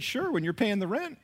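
high-pitched sarcastic dad retort; mock-agreeing comeback. Dad answering son's request, sarcastically agreeing. Retold comeback to his son.

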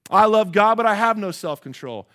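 loud, high imitated declaration; acted protest, bursting out loud. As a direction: blurt it at full volume, then ease off.

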